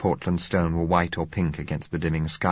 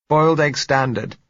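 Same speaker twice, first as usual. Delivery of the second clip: high, louder reply through a door; muffled woman's voice; 9 semitones higher, 6 dB louder than usual. A narrator's reading. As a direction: call back as a woman from another room. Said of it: "said her muffled voice".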